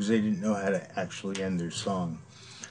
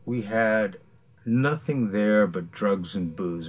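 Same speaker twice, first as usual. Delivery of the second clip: ordinary delivery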